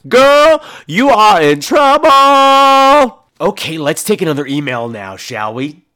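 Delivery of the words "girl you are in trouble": high, loud, drawn-out holler; Tootie Ramsey. Acted. Quoting her finest moments, high loud mock scolding. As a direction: stretch it out in a big high-pitched yell. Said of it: "as Tootie Ramsey said at her finest moments".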